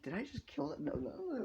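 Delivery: high, hushed alarm; hammed-up small, high worry; mock panic. Acted panic after apparent killing.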